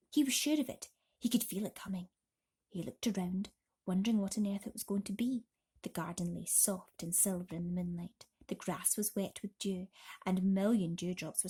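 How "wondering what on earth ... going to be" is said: ordinary delivery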